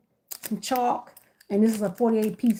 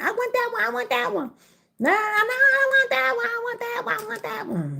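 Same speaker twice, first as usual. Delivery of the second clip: put-on whiny kid begging; 13 semitones higher than usual. Mom hears her kid begging for that basket, high whiny child voice. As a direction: beg like a little kid for the basket. Kids begging at egg hunt.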